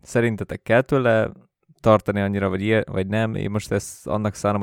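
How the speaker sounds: ordinary talk, nothing marked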